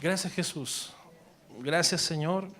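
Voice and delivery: earnest prayer voice, addressed to God; spoken prayer. Acted. Pastor praying over the congregation, earnest prayer. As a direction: pray it aloud, intimate and steady.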